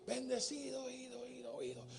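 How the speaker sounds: softening distant echo; fading echo, faraway. Retold or imitated. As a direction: repeat the tail of the word as it fades. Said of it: "Y el eco le respondió".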